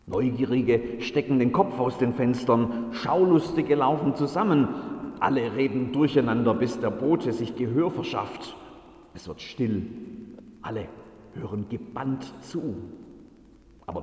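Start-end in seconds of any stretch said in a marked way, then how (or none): none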